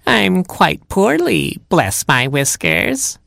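high, slow, poorly granny voice; cat posing as grandma. Acted. Cat tells Robin he is poorly, high mock-granny voice. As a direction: play a sickly granny, drawn out and high.

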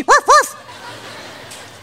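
high, silly woofing puppy; cartoon puppy woof; dog bark. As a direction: bark it like a tiny yappy dog. Dog barks in silly story.

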